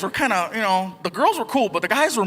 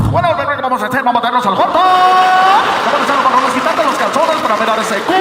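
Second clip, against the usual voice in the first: loud, booming radio announcer talk; husband answers his wife in DJ voice, hammed-up; mock DJ seduction; 4 semitones higher, 7 dB louder than usual. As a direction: seduce your wife like a Spanish DJ.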